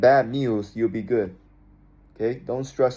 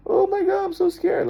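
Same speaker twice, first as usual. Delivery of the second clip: much higher, squeaky mock terror; mock terrified. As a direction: overact being scared of death. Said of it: "look at death and be like".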